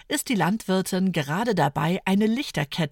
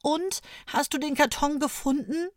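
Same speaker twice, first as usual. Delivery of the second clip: ungeduldig und hoch drängen; 8 semitones higher than usual. A narrator's reading. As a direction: Fiete kann es kaum erwarten. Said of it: "fragt Fiete ungeduldig". (ungeduldige Kinderfrage)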